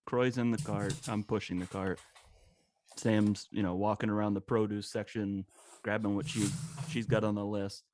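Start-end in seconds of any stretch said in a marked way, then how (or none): none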